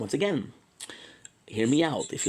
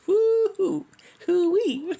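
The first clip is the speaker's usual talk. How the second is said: high whooping cheer, louder; excited whoop; 7 dB louder, much slower than usual. Real, not acted. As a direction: whoop it out like a hype cry.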